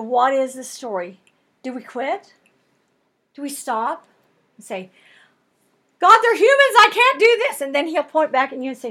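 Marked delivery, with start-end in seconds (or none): 6.0-7.6 high, loud plea: "I can't do this"; exasperated cry to God. Retold or imitated. A worn-out believer crying out to God, loud and exasperated. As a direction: throw up your hands and cry out, much louder and higher.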